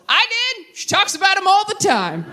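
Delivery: mock dad. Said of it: "my dad goes".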